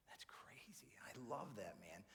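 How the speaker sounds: soft voiceless aside; whispered. Real, not acted. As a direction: whisper it to yourself. Quiet aside after preaching.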